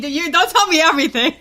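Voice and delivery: laughing. Real, not acted. Laughing while teasing guest.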